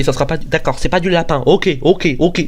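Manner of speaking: ordinary talk, nothing marked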